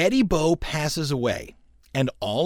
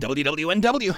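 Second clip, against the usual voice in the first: radio announcer voice; 3 semitones higher than usual. Shown as a demonstration. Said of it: "the way Dave Barner told me to do it".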